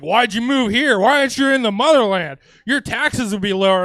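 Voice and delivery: lightly mocked outraged California bro; indignant truck bro. Mimics California truck guys challenging him.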